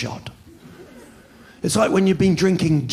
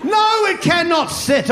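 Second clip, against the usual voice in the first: emphatic insistence. Acted. Emphatic correction.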